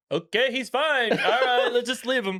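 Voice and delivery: high-pitched, amused mock unconcern; mock breezy dismissal. Mockingly voices the characters' careless reaction.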